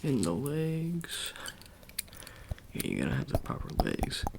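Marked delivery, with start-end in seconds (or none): 0.0-1.3 whispered, then drawn out slow; secretive whisper. Acted. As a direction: hush it like a secret spot. Secretive injection location.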